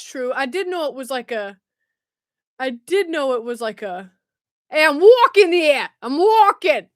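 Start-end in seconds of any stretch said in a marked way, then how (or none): 4.7-6.9 put-on loud, tense Boston accent; amateur Boston street accent. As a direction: belt it out in an attempted Boston accent. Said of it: "try and do a Boston accent".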